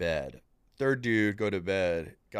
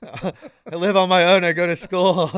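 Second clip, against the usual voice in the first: high, loud, slowed mock protest; hammed-up insistence; mock indignant protest; 9 semitones higher, 11 dB louder than usual. Teen insisting to doubters he has a job, high and loud. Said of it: "You're like".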